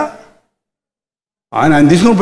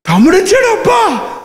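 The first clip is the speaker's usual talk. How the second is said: calling out; 10 semitones higher than usual. Acted. Calling out the brother's return.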